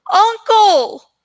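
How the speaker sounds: frantic cry, calling again; desperate shout. Acted. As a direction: shout in growing panic. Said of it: "Aragon cried again".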